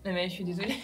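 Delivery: usual speaking voice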